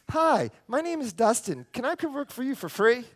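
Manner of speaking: high, loud eager introduction; young kid. Retold or imitated. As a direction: pitch it up like a keen young kid. Imitates the young kid's introduction.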